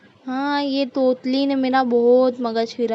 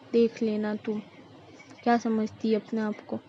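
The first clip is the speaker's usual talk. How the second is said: grumbling mother-in-law. Acted. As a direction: complain under your breath about the bahu.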